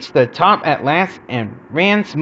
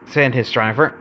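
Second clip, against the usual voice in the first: usual speaking voice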